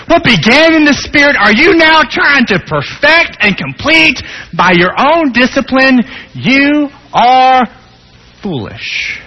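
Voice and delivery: high, loud rebuking cry; full-force preaching. Acted. Preacher rebuking foolish Galatians, at full force. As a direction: thunder the rebuke.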